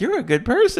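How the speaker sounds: high, gentle Mister Rogers voice; Mister Rogers impression. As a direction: say it like a kind TV host to a child. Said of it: "He's like".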